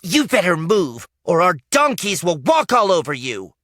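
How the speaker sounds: high, loud warning; girl threatening to ride them down; high, loud threat. Acted.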